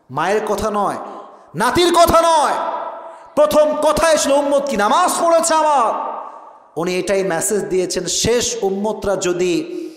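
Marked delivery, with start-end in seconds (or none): 1.6-6.1 high, ringing sermon climax; impassioned preaching. Real, not acted. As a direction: drive the point home to the congregation. Preacher builds to climax of story.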